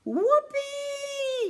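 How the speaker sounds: very high, loud whoop; joyous yell. Acted. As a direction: whoop with delight at speed. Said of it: "yelled Jacob".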